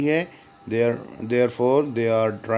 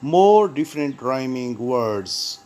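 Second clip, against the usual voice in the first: slow heading read; much slower than usual. Shown as a demonstration. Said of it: "reading section heading".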